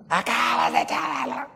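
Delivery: loud, furious yell at a driver; angry exclamation. Shown as a demonstration. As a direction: explode at whoever hit your car. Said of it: "hablas más rápido y más fuerte".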